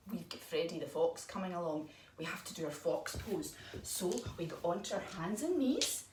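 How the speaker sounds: normal talking voice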